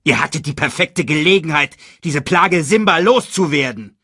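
high, loud villainous reproach; angry scolding. A narrator's reading. Villain scolding hyenas for a lost chance, angrily. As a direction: berate them, voice pushed up.

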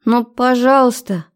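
put-on high begging girl voice; pleading. Girlfriend begs him to dance.

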